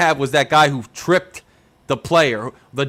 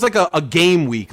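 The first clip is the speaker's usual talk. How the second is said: ordinary talk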